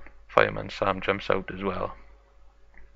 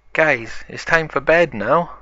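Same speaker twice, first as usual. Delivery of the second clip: raised, higher-pitched call; 6 semitones higher, 5 dB louder than usual. Acted. Parents call the children to bed, calling out. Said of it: "calls to 'em and says".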